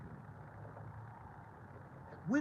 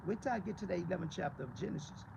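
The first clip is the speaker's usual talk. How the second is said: ordinary talk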